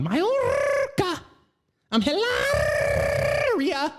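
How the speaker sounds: put-on very high Spanish accent with long trilled r; Hilaria's Spanish accent. Comedian mocking actor's wife introducing herself, absurd Spanish accent. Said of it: "The Spanish accent".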